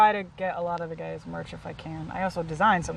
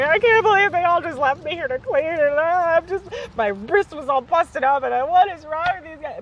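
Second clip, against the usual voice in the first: higher, louder, mock distraught complaint; reliving the crying outburst, pitched up; retold tearful rant; 8 semitones higher, 5 dB louder than usual. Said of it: "I'm crying".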